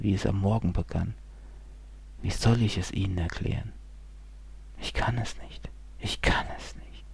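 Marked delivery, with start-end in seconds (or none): 2.2-7.0 unvoiced, softer whisper; whispered despair. A narrator's reading. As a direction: whisper helplessly. Despairing inner voice.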